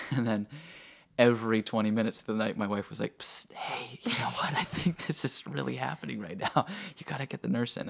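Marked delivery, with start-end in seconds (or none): 3.2-7.9 urgent stage whisper, imitated; retold whisper. Wife waking husband to get the nurse, urgent whisper. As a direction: imitate an insistent hiss to someone asleep.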